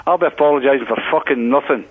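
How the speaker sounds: normal talking voice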